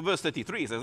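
ordinary delivery

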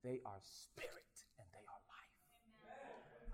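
hushed, slow whisper; whispered scripture. Retold or imitated. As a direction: breathe the words of Jesus. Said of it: "and Jesus says".

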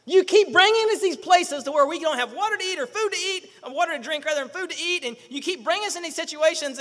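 high, loud outburst, then easing in volume; fed up. Acted. Preacher voices the Israelites chiding Moses, fed up. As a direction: play an exasperated crowd complaining at their leader. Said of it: "they're fed up with you".

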